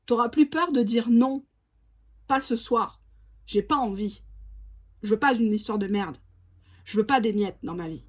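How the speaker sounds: normal talking voice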